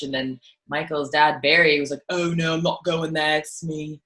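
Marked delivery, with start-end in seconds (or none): 2.1-4.0 hammed-up northern English dad voice for "Oh no, I'm not going there"; a father-in-law teased in an exaggerated English accent; parody British accent. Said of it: "a parody accent that sounds nothing like his parents".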